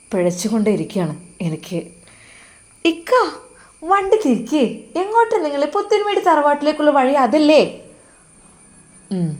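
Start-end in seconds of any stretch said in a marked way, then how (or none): 2.8-7.6 put-on higher, louder young wife's voice, puzzled; a young wife asking where the road went, pitched high; worried young wife. Wife Nusaiba asking her husband about the wrong road, high and worried.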